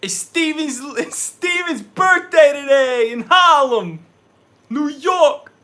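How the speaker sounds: put-on loud, high Harlem New York accent. Wishing Steven happy birthday, loud high New York accent. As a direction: belt it out like a Harlem New Yorker. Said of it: "What's your favorite accent impersonation?".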